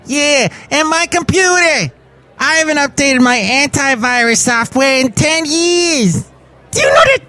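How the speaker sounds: squeaky, high-pitched voice of a neglected computer. Acted. Computer chiming in with a squeaky high voice. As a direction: play the computer, whining way up high. Computer voice complaining about antivirus.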